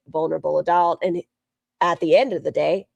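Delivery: ordinary talk, nothing marked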